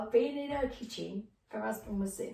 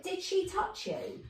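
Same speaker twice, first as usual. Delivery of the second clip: son asking; 10 semitones higher, slower than usual. Retold or imitated.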